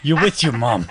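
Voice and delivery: normal talking voice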